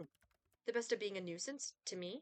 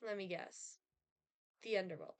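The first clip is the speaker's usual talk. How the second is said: normal talking voice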